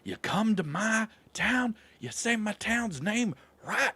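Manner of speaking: wag a finger in a put-on voice; mock local scolding. Mock scolding visitors over town name.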